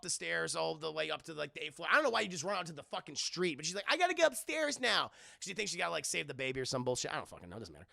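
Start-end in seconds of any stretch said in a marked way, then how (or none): 3.9-5.1 higher, hammed-up urgency; mock urgent heroine. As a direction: play the frantic heroine.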